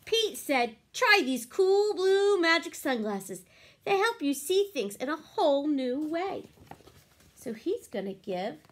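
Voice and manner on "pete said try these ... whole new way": bright, lively storybook reading; reading to children. A narrator's reading. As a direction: read it like a picture book for kids. Reading picture book aloud.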